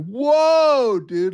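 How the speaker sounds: high, loud startled "Whoa, dude". Retold or imitated. Streamer reacting to his buddy's rant, startled high yelp. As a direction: yelp it in shock at a buddy's outburst. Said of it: "and I was like".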